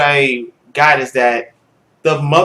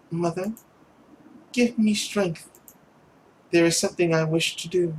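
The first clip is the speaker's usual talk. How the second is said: pleading daughter; 2 semitones higher, 7 dB quieter than usual. Acted. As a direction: beg mother gently to stay. Voices the girl.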